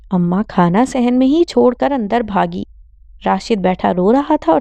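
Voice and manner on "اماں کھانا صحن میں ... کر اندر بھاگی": usual speaking voice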